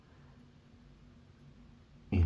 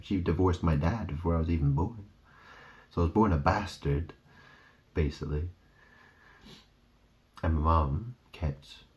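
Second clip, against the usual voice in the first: ordinary delivery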